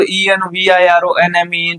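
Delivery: ઈ એન વી આઈ આર ઓ એન એમ ઈ letter by letter; spelled out. Shown as a demonstration. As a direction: recite each letter name, ઈ એન વી, in a string. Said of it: "એન્વાયરમેન્ટનો spelling બોલો". (ઈ એન વી એમ એક-એક અક્ષર બોલીને)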